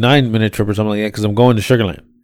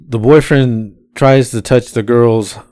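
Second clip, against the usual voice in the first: normal talking voice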